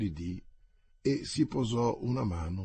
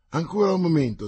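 insistent call; 7 semitones higher, 8 dB louder, faster than usual. Acted. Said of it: "trattenendoli con un cenno".

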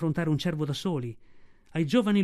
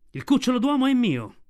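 higher, louder fierce claim; fierce she-wolf; 8 semitones higher, 5 dB louder than usual. A narrator's reading. Mother Wolf defies the tiger, fierce loud higher claim. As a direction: snap back like a she-devil guarding her cub. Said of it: "la diavola che ti risponde".

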